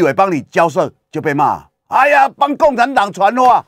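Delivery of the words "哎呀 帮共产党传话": mock scolding. Critics mock the Kinmen legislator.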